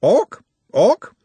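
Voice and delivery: high, loud screech, repeated; bird-like cry of alarm; squawking bird creature. Acted. The sisters attack Nut, screeching.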